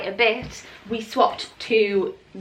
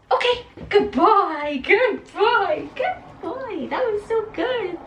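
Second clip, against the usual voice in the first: rising higher and higher praise; 10 semitones higher, slower than usual. Real, not acted. Owner praising her puppy, very high. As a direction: praise the dog, climbing up high.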